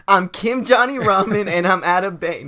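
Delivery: slow, loud goofy robber line; hammed-up bank robber voice; loud mock robber. Robber's line in silly scenario.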